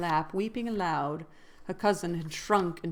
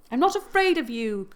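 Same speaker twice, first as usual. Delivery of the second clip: higher and louder, daring him; defiant exclamation; 5 semitones higher, 7 dB louder than usual. A narrator's reading. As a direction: throw the challenge straight at him. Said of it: "exclaimed Catherine".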